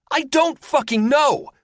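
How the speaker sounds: very high, slow furious yell. Acted. Gunner yells at the pilot, frantic and angry. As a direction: scream it in panic. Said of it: "Athos yells".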